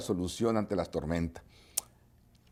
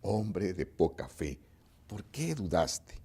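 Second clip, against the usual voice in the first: much softer, lower, slow reproach; gentle rebuke; 6 semitones lower, 10 dB quieter, slower than usual. A narrator's reading. Jesús rebuking Pedro after saving him, soft and slow. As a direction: scold him tenderly, almost hushed. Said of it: "le reprendió".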